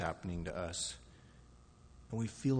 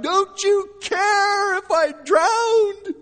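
very high, drawn-out desperate yell; crying out; 12 dB louder, much slower than usual. Acted. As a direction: cry out at full force, slowly. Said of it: "And we cry out".

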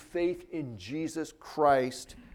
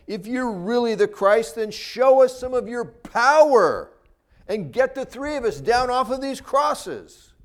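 louder, higher taunting challenge; mock sarcastic jeer; 7 semitones higher, 8 dB louder than usual. Crucified criminal taunting Jesus to prove himself, sarcastic. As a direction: jeer it loudly, as a preacher imitating a scoffer. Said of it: "He sarcastically said".

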